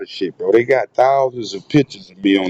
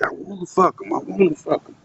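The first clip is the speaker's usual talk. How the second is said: mock high repeat; 7 semitones higher, 4 dB quieter than usual. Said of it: "She said".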